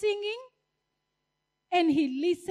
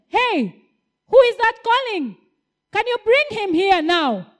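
loud, higher commanding call; big commanding voice; 4 semitones higher, 5 dB louder than usual. Acted. Jesus ordering the men, loud and commanding. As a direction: order the men to fetch him. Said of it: "with a big voice, commanding them".

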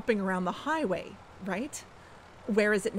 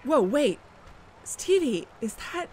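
louder, rising startled call; sudden recognition; 3 semitones higher, 3 dB louder than usual. Acted. Robin to Stevie on spotting the doctor, startled. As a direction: stop short on seeing someone. Spots someone she recognizes.

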